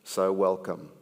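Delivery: rhythmic slam poetry delivery with pointed pauses; poem recited to a live crowd with rising punch; spoken word poem. Acted. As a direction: perform it like a slam poet building to a sting.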